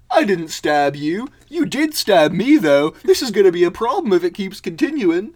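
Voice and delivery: loud, high protest; hammed-up bickering henchman; squabbling mole. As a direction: argue back at full volume, aggrieved. Moles' shouted quarrel continues.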